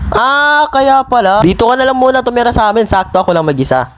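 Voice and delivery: usual speaking voice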